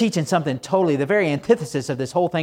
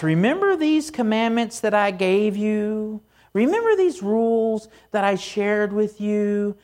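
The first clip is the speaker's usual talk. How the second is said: "Remember these" raised high and drawn out; slow, higher-pitched reminder, stretching "Remember these"; reiterating reminder; 4 semitones higher than usual. Retold or imitated. God reiterating rules to the Israelites, "Remember these" high and drawn out. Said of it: "He has to kind of reiterate".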